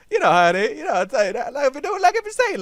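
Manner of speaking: high, laughing squeaky riff. Real, not acted.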